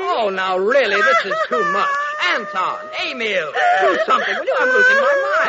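desperate, overwhelmed father; exasperated. Acted. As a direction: throw up your hands in despair. King exasperated amid chaos.

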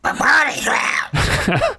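high, slow squawking duck voice; Donald Duck voice. Acted. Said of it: "That was the worst duck voice I've ever done".

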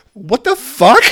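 high, loud "what the fuck" shot out without thinking; blurted outburst. Retold or imitated. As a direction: blurt it out in pure disbelief. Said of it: "And the restaurant goes quiet for a second".

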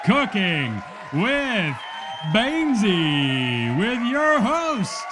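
hugely drawn-out TV host introduction; show announcer. Acted. Announcer introducing Bainesy to studio audience, drawn-out showman build-up. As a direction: stretch every name, climbing to a big finish.

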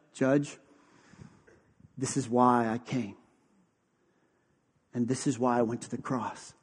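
low, soft, intimate preaching; hushed sermon. Acted. Pastor to congregation, hushed intimate preaching. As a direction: draw the congregation close and speak gently.

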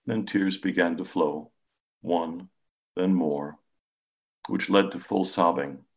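ordinary delivery

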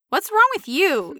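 high, slow nosy demand, imitated; mock-prying high voice; rude questioner. Shown as a demonstration. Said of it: "somebody comes up to you and says".

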